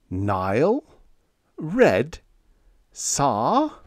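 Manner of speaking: musing aloud, each word a separate question; muttering to himself. A narrator's reading. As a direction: puzzle over single words, rising on each. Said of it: "was still muttering to himself".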